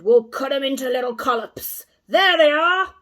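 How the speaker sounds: loud, high wolf voice; bloodthirsty wolf yelling the alarm; shouting wolf. A narrator's reading. Second wolf spotting Kay and the mouse, loud shout. Another wolf spots them.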